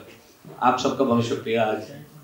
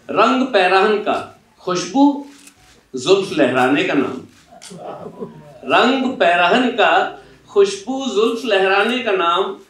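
higher, lilting shayari recital; reciting Faiz; 5 semitones higher than usual. Acted. Interviewer recalling Faiz for the author, recited verse.

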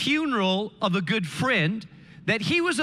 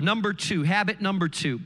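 ordinary talk

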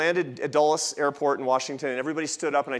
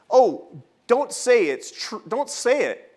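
pleading wife; 7 semitones higher, 4 dB louder, much slower than usual. Retold or imitated. Wife pleading with husband on plane.

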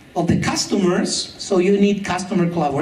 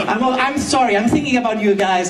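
ordinary talk

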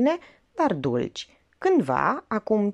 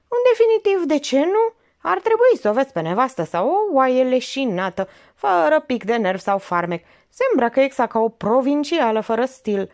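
put-on higher, louder scornful young woman; young mistress, scornful; 7 semitones higher, 5 dB louder than usual. Olga sneering at her lover's wife, higher and louder.

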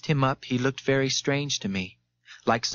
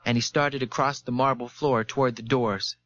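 usual speaking voice

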